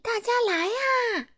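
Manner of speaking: high, carrying call to the crowd; bright calling voice. Acted. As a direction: summon everyone from afar. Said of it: "她扯着嗓子喊道".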